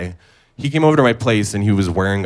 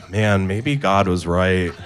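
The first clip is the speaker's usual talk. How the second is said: slow rueful resignation; slower than usual. Retold or imitated. Rueful punchline reflection.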